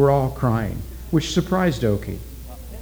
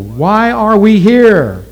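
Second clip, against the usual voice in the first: higher, louder, slow ritual cry; ritual call; 8 semitones higher, 8 dB louder, much slower than usual. A narrator's reading. Witch doctor crying out to the villagers, loud and slow. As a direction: call out to the crowd like a priest.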